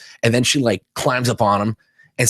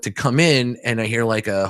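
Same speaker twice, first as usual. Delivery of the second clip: ordinary delivery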